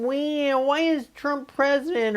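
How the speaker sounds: high, slow, self-pitying whine; mock the person who feels everyone is mean to them; a high whiny poor-me voice; whiny victim voice.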